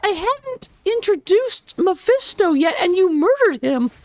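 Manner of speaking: mock exasperated complaint; mock outrage. Real, not acted. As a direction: playfully scold the chat. Chat killed Mephisto before intro.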